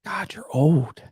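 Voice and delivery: teasing whispered jab. Real, not acted. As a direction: breathe it as a sly aside. Teasing aside to partner.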